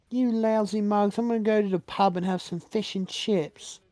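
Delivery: high, hammed-up mock British; exaggerated British accent. Mocking Tracy's insult for Penguin's guys, exaggerated British accent. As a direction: parody a British pub regular, pitched high. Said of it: "that's the most British thing".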